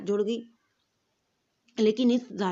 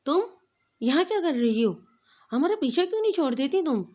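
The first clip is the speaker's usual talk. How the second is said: angry confrontation, higher and louder; 6 semitones higher, 4 dB louder than usual. A narrator's reading. Rival confronts the first wife at the door, angry and loud. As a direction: snap at the intruder in anger. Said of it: "Vania نے غصے سے".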